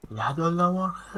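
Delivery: high, loud, slow call; shout. Real, not acted. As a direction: yell the name across the field. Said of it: "یه داد بزن یدالله مرغی".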